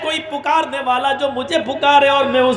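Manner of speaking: high, rising preacher's cry; fiery preaching. Acted. Preacher cries out to the congregation about God's nearness. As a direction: lift the voice to the heavens.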